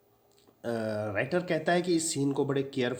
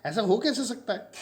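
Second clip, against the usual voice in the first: high, louder disbelieving cry; astonished protest, voice jumping up; surprised; 9 semitones higher, 4 dB louder than usual. A narrator's reading. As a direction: gasp it out as if it cannot be true. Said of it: "Mrs Pearson बड़ी surprise है".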